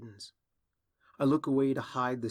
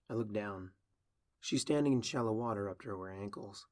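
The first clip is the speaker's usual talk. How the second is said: plain reading voice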